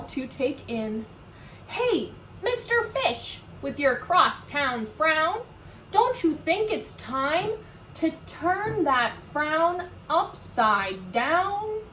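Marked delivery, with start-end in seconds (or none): 1.7-11.8 high, bright-natured clam voice, slower at the end; friendly clam's pep talk; cheery clam. Acted. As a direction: coax the fish along, much higher.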